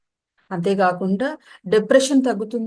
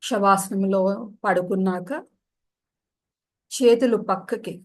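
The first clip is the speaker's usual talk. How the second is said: calm yoga nidra guidance with long pauses; guided meditation. Real, not acted. Yoga teacher settling listeners into savasana, guided meditation voice. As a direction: ease them down into stillness, unhurried, pausing between instructions.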